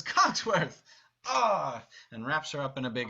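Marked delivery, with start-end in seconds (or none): 0.0-1.8 higher, louder delighted cry; excited greeting. Shown as a demonstration. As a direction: burst out with glee at seeing him. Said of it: "looks over at Cogsworth and says".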